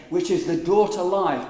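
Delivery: normal talking voice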